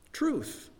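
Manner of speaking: serene God. A narrator's reading. Storyteller voicing God answering the devil, hushed and serene. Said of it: "said God serenely".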